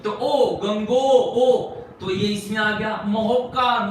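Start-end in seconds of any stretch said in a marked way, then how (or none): 0.6-1.6 गंगो, ओ said slowly and loudly, the ओ stretched on its own; sound demo. Shown as a demonstration. Teacher showing students the hidden ओ sound, slow and loud. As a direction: break गंगोर्मी at गंगो, then sing out ओ. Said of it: "बीच में sound को, यानी उसके उच्चारण को".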